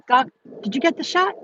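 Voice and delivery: pointed question. Retold or imitated. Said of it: "That's what I said".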